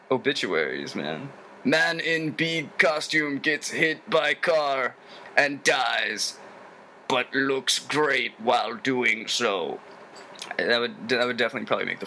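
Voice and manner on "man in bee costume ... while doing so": slow, drawn-out mock headline read; tongue-in-cheek newsreader delivery; mock headline reader. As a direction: announce it like a grand obituary headline. Mock obituary headline about himself.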